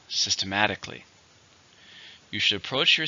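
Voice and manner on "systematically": systematically with "-mat-" stressed; teacher models systematically, weight on "-mat-"; stress on the fourth syllable. Shown as a demonstration. Model the word for students, stress on "-mat-". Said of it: "Stress is still on the fourth syllable".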